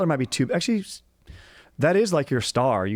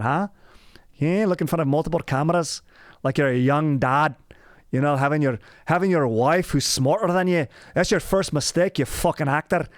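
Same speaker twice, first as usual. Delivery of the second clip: high, mocking Scottish-accented tirade; sneering mock-Scottish scold, pitched up; Scottish self-berating; 7 semitones higher, 4 dB louder than usual. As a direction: berate yourself in a hammed-up Scottish brogue. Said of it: "That's how I talk to myself".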